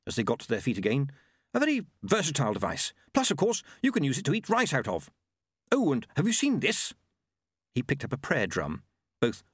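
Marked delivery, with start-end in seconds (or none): 1.5-6.9 eager inventor monk, higher voice. Acted. Monk showing off a versatile device, eager. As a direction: gadget salesman patter, pitched up.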